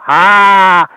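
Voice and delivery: one long, loud, held "ha"; signature comedy laugh. Shown as a demonstration. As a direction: blast out a single drawn-out comic laugh. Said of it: "that was always my laugh".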